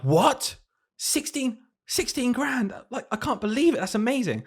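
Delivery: high, squealing amazement; mock parental astonishment; excited disbelief. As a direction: gasp it out like stunned parents. Parents' excited reaction retold.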